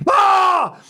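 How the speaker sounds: high mock yell; high-pitched cry of urgency; frantic yelp. Man loading his rifle, frantic yelp.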